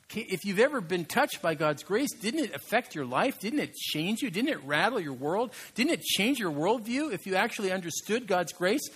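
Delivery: impassioned sermon climax; preaching at full force. Acted. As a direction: drive each question home to the crowd. Rousing rhetorical questions to congregation.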